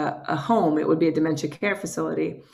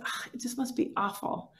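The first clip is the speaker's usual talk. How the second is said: hushed dad quote; 3 semitones higher, 12 dB quieter than usual. Retold or imitated. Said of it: "and then he said".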